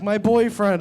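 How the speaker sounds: put-on squealed high and loud like an excited girl; high little girl call. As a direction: shriek it like a kid running out. Girl runs out shouting.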